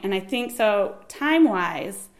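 ordinary talk, nothing marked

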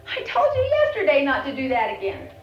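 loud angry scolding; mad parent. Acted. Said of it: "I'm really gonna get mad".

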